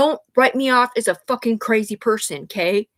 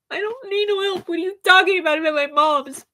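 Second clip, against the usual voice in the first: much higher, startled protest; indignant disbelief; 8 semitones higher than usual. Retold or imitated. Retelling her answer to a worried friend, baffled and indignant. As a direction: fire it back, baffled and defensive. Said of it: "I'm all".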